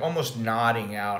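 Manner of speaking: ordinary talk, nothing marked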